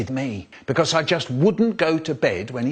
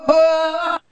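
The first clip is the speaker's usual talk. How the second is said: startled gasp; 6 dB louder than usual. Acted. Startled at door opening.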